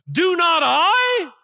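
loud, high, slow outraged protest; defensive shout of self-justification; indignant shout. Acted. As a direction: fling the question at God. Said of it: "now he goes to defending himself".

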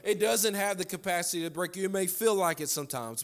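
high-pitched, loud pulpit fervour; rally the congregation with driving, ringing repetition; impassioned preacher in full cry; full-force preaching. Real, not acted.

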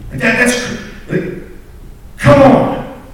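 rising to a high, loud "come on"; stunned disbelief breaking out; amazed outburst. Real, not acted. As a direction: marvel at it, almost shouting.

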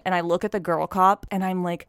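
ordinary delivery, nothing marked

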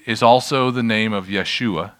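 ordinary talk

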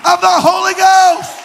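loud, rising sermon delivery; fiery preaching. Acted. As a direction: build the scripture to a roar. Preaches Acts 2 at full force.

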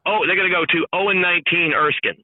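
ordinary delivery

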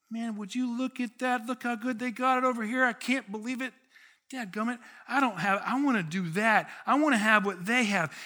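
high-pitched, worked-up envy; envious grumbling. Acted. Sheep-like grumbler eyeing other people's good life, envious.

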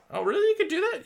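high-pitched mock surprise; pleasantly astonished question; amazed customer. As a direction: play an impressed customer, voice leaping up. Acted customer amazed by offer.

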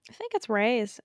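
ordinary delivery

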